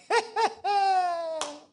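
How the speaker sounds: loud high burst of laughter. Real, not acted. Preacher laughing at the congregation, loud and high.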